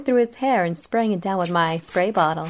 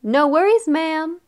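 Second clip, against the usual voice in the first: higher, louder cheerful reassurance; cheerful call; 7 semitones higher, 4 dB louder than usual. A narrator's reading. Barber reassuring the mother, cheerful. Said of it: "I called cheerfully".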